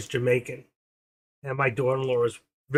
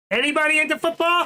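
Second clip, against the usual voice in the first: much higher, louder sing-out of the question; calling out to chat; 6 dB louder than usual. Pretend. Streamer calling out to his viewers, loud and high. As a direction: call it out like hailing a crowd.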